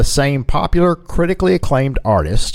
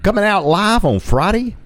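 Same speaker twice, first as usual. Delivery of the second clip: ordinary talk, nothing marked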